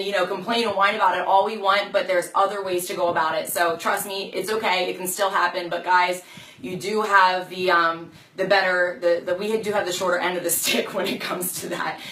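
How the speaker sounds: normal talking voice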